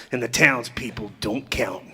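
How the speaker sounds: hushed aside. Acted. Outlaw leader rallying his gang.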